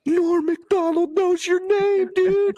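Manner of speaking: very high, slow, awestruck; starstruck inner squeal. Retold or imitated. Host starstruck by Norm knowing his name, high squeal. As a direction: squeal it to yourself, amazed. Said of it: "in my head going".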